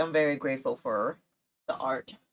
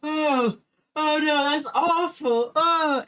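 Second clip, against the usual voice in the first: much higher, louder, slow gasping dismay; dramatic reaction; 13 semitones higher, 9 dB louder, much slower than usual. Retold or imitated. Cousin on phone, overly dramatic shock. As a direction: ham up the horror. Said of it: "He was like".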